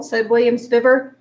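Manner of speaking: usual speaking voice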